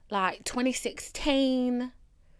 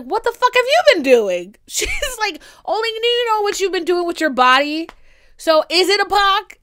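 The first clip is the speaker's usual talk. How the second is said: loud, higher outraged mom; mom yelling; 9 semitones higher, 8 dB louder than usual. Retold or imitated. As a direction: yell it at your kid.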